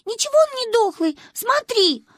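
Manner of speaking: put-on high-pitched girl's objection; childish, insistent protest; indignant child. A narrator's reading. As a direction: contradict grandma in a high child voice. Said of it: "возмутилась Манюня".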